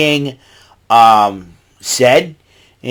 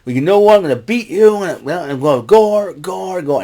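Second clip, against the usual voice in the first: intense; 6 semitones higher than usual. Retold or imitated. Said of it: "Rhino got intensities".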